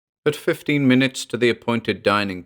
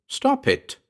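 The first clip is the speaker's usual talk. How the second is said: sudden high-pitched protest; cried out. A narrator's reading. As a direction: cut in with a high, urgent cry. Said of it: "I cried".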